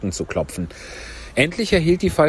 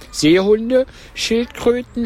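high, loud turtle voice; Mock Turtle calling out; 12 semitones higher, 4 dB louder, slower than usual. A narrator's reading. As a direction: cry out the list high. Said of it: "rief die falsche Schildkröte". (hoch rufende falsche Schildkröte)

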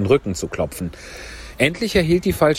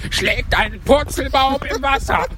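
high, wild cry while leaping; jumping about wildly. Acted. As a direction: shout it exuberantly, bouncing all over. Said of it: "Ich bin unbändig umhergesprungen". (unbändig hüpfend, hoch gerufen)

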